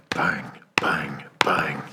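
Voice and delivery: hushed whisper of a pounding heart; tense whispered heartbeat. Acted. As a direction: breathe the bangs out under your breath.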